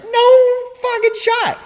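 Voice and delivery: very high, loud and slow; outraged disbelief. Retold or imitated. To his buddy, slow, very high outraged disbelief. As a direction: stretch it out in shocked outrage.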